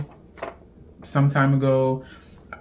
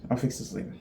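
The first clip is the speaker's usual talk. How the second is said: a quieter echo of the line before; much softer; 10 dB quieter, much faster than usual. Real, not acted. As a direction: let it drop to a murmur. Repeats line, fades out.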